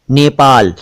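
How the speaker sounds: "नेपाल" as ney-PAAL; correct close vowel. Shown as a demonstration. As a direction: say "नेपाल" with a narrow ay. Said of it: "नेपाल नहीं".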